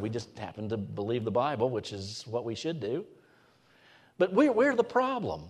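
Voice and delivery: usual speaking voice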